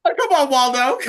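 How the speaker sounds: high playful shout; teasing call. Real, not acted. Joining friends teasing Colin, high loud playful call. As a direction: cheer him on mockingly.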